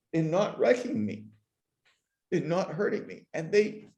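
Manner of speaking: really choked up, pitch lifted, halting. Real, not acted. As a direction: fight back tears between phrases. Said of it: "I'm actually getting choked up".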